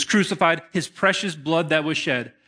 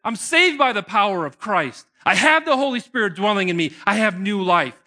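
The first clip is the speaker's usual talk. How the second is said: whooped start then higher, louder exultant declaration; 5 semitones higher, 5 dB louder than usual. Pretend. Preacher voices joyful Christians declaring salvation, exultant. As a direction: celebrate your salvation out loud. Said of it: "Christians that are going".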